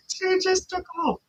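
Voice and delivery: high-pitched voice through laughter; helpless high laugh in the words; real laughing. Real, not acted. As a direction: crack up and squeak it out. Breaks up laughing at the runner.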